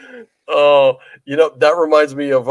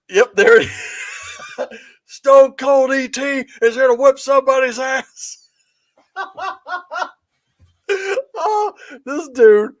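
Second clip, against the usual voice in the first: high, laughing hard; 12 semitones higher, slower than usual. Real, not acted. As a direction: crack up while talking. Cracking up at the prank.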